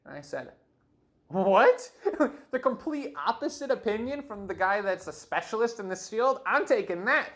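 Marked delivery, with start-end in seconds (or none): 1.3-2.3 loud, high disbelieving what then laugh; stunned joy at good news; shocked what. Retold or imitated. Hearing no surgery needed. 2.5-7.3 higher, louder, thrilled; buzzing with relief; excited relief. Real, not acted.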